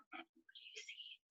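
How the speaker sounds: breathy whispered question; hushed storytime whisper. Real, not acted. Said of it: "teacher asks class softly".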